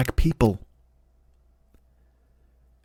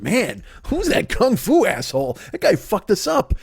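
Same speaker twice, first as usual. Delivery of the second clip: mock beaten-up townsman; 8 semitones higher than usual. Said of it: "they're just like".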